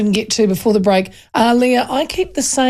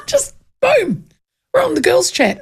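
ordinary talk

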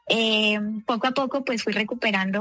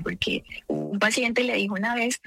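usual speaking voice

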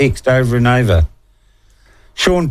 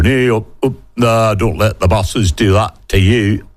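mocking union activist.

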